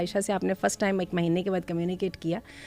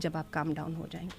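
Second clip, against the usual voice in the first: much softer, cool and even; calm low tone; 4 semitones lower, 9 dB quieter than usual. Shown as a demonstration. Telling a yelling spouse to calm down first, softly. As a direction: set the boundary quietly, without heat. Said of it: "لو tone کے ساتھ".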